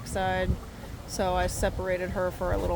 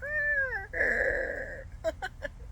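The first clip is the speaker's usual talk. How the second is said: imitated rooster crow. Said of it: "sounds like it's sucking the air back in".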